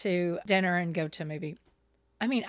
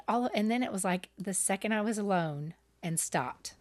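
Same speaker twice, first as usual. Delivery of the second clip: ordinary talk, nothing marked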